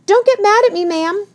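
very high, anxious protest; defensive plea. A narrator's reading. Tilly answers Missus Higgins, defensively pleading.

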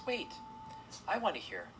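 high, sweet but firm; strong sweet voice. A narrator's reading. Sybil asking Mona for her plan, sweet firm voice. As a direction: cut in firmly yet sweetly. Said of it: "said strongly in her sweet voice".

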